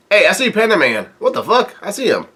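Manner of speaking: high-pitched, delighted double take; real surprise, voice jumping up high; surprised excitement. Real, not acted. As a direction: blurt it out on spotting someone unexpected. Spotting a character while reading.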